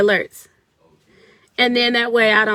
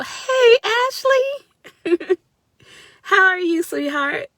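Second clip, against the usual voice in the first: much higher and louder, delighted hello; excited greeting; 10 semitones higher, 4 dB louder, slower than usual. Real, not acted. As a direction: greet a dear friend with joy. Greeting a viewer on live.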